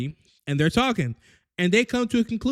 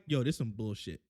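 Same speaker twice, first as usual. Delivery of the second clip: grumbling; 10 semitones lower, 11 dB quieter than usual. Acted. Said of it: "they're already like".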